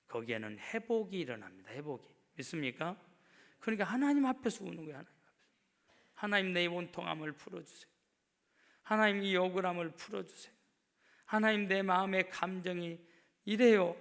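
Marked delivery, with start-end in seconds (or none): 3.6-5.0 rushed, higher, louder line; fast emphatic 하나님 앞에서. Acted. Repeated phrase. 5.0-5.3 하나님 앞에서 breathed almost silent; whispered 하나님 앞에서; whispered echo. Acted. Repeated phrase. 6.1-14.0 loud plea. Acted. Model prayer of grievance.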